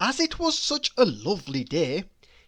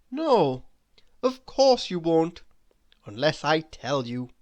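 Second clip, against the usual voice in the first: plain reading voice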